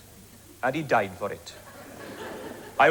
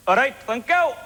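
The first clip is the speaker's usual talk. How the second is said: higher chirpy dummy voice; polite biro voice; 6 semitones higher than usual. Acted. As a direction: answer brightly as a polite pen. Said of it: "with the Welsh way".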